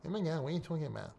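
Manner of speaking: quick, muted put-on troll voice; mocked idiot commenter. Idiot in the comments challenging him, quick mocking imitation. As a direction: rattle it off softly, mimicking a heckler. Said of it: "That's how they talk".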